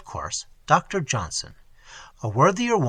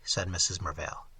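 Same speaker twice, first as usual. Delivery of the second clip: plain reading voice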